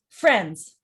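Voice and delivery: "Friends" as one loud, falling beat; one stressed syllable. Shown as a demonstration. Teacher counts syllables, "Friends" loud and stressed. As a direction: punch "Friends" firmly. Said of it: "Just one stressed syllable".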